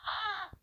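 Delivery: high laughing cry of delight; hearty laugh. Acted. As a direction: let out a big laugh. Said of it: "Laughing heartily".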